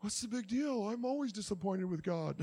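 a hint of a careless congregant brushing it off; preacher mimicking a listener's inner thoughts, softly; mock churchgoer. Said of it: "some of you are probably thinking".

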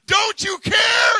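stretched pleading yell; desperate shout. Retold or imitated. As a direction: cry out to be heard over the storm. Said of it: "and we're like".

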